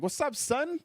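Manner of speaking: high hype greeting. Retold or imitated.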